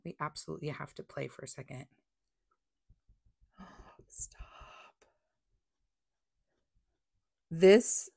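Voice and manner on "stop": hushed, drawn-out disbelief; awed whisper. Real, not acted. Crafter overwhelmed by the ink result, awed whisper.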